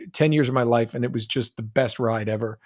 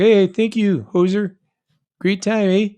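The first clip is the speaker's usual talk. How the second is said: higher, louder Canadian caricature; put-on Canadian greeting, pitched up; Canadian accent; 6 semitones higher, 4 dB louder than usual. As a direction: play up a friendly Canadian "eh". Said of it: "I can do Canadian".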